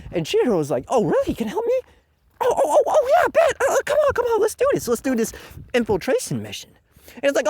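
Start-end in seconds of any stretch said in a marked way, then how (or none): 0.9-6.7 high-pitched, over-keen impression; mock eager Chihiro. Hero accepting help for infiltration, mock-eager. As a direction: jump at the offer with hammed-up excitement.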